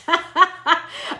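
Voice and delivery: a short, real, high laugh; quick amused burst of laughter. Real, not acted. Laughing at a comment.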